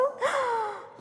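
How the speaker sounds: high, playful gasp; surprised gasp. Pretend. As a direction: gasp at what you have drawn. Reacts to drawn word card.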